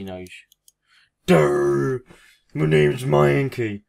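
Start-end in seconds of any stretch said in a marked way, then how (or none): 1.3-3.8 loud, high, slow goofy monster voice; dopey Mankey voice. Acted. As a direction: bellow it like a dim cartoon creature. Voicing the Mankey he drew.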